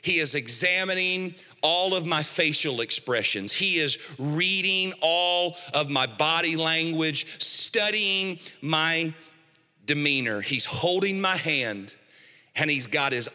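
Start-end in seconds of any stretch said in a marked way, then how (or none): none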